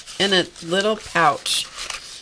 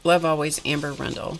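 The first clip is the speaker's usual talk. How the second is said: normal talking voice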